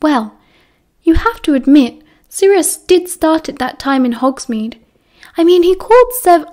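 Lily defending Sirius's guilt to Remus; rising protest. A narrator's reading. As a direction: push her point harder until cut off. Lily arguing, cut off.

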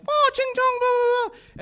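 a cartoonish mock taunt thrown at someone. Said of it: "you can say".

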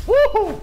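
high, loud whoop; excited woohoo. Real, not acted. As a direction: whoop it up mid-fight. Fighting in the game.

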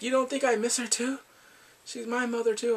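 high-pitched acted reproach; telling her off. Grieving man telling her off over their mother, reproachful. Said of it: "then he tells her off".

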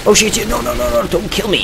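high-pitched, rapid-fire panic; panicked pleading. Real, not acted. Player begging the game enemy for life, panicked. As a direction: plead in alarm, voice jumping up high.

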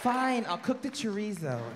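high, slow mock-meek reply; meek wife. Wife backing down to the husband, slow mock meekness. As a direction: give in sweetly. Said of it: "She went".

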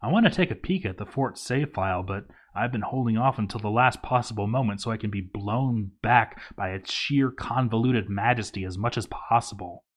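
normal talking voice

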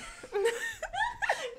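high squealing laugh; high giggle. Real, not acted. Friend laughing at the mask, high giggle. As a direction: burst into a giddy giggle.

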